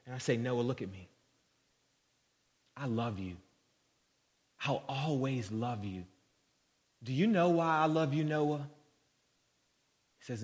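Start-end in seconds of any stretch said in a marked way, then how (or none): 0.3-8.7 quiet, tender retelling of a father's words; tender reassurance. Retold or imitated. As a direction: gently comfort a crying child. Father reassures his crying son.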